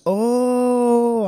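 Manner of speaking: long, high-held taunting "oh"; mocking taunt. Cops taunting prisoners through the windshield, mocking. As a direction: crow it like someone caught them red-handed. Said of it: "they start taunting us".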